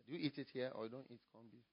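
usual speaking voice

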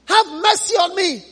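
a damned man shouting for mercy; pleading shout. Acted. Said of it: "he shouted and he said".

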